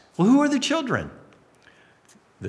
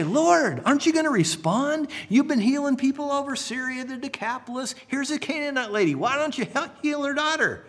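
higher, earnest plea to the master; pleading disciples; 8 semitones higher than usual. Pretend. Disciples urging Jesus to heal the Canaanite girl, higher pleading. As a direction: urge him gently to act, a touch raised.

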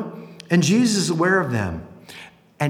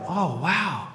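ordinary talk

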